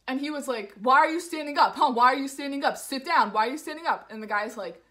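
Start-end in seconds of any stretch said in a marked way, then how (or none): 0.8-4.1 loud, pitched-up repeated demands; imitated aggressive challenge. Man challenging husband who stood up, imitated loud aggression. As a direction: mimic a loud, badgering aggressor.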